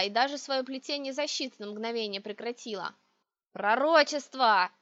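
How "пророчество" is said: put-on slow, loud old witch's yell. A narrator's reading. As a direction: bellow it drawn out like a crazed crone. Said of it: "заорала старуха".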